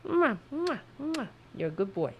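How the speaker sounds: high kissy smacks then soft praise; cooing kisses. Real, not acted. Kissing his cat good boy, soft high cooing.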